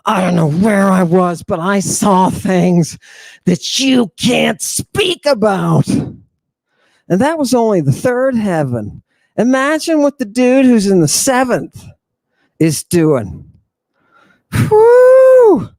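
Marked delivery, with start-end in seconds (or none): 0.1-5.9 hammed-up ecstatic apostle; Saint Paul voice. Retelling Saint Paul after the party, dazed shouting. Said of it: "And he was like". 14.5-15.7 very high, loud whooping whew; ecstatic whoop. Acted. Stirred up by the vision, a high loud whoop.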